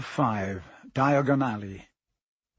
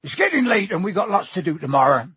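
loud, raised giant's voice, changing the subject; higher, louder, brisk dismissal; loudly; 6 semitones higher, 7 dB louder than usual. A narrator's reading. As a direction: cut the question off loudly. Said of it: "said Hagrid loudly".

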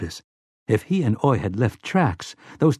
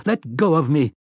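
higher pleading cry; pained plea; 5 semitones higher than usual. A narrator's reading. Slightman begging the robot to release him, pained. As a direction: beg to be let go. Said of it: "Slightman cried out".

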